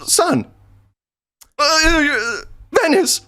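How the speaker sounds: very high, halting squeak; hammed-up imitation of panic; mock flustered voice. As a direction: blurt it between awkward pauses. Role-play of friend's flustered replies.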